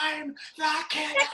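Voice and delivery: high, laughing campy menace; mock killer line. Repeating the movie's audition line, high and laughing. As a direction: toss it off as a silly villain.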